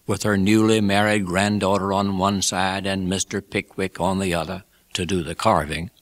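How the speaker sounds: ordinary delivery, nothing marked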